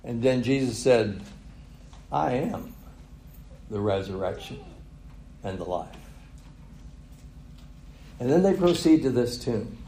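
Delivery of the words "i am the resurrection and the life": solemn declaration. Retold or imitated. Jesus' solemn declaration to Martha.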